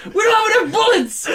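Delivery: very high, loud mock panic shout; exasperated yell. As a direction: yell at him in comic despair. Exasperated yelling in game story.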